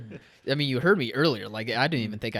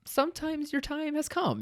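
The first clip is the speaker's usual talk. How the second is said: mock solemn; 13 semitones higher, 4 dB quieter than usual. Mock wise pronouncement.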